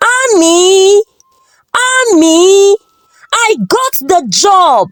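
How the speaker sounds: calling out the news at the top of his voice; excited shouting. A narrator's reading. Husband bursts in calling his wife with the news, excited shouting. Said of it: "Tobena screaming with excitement".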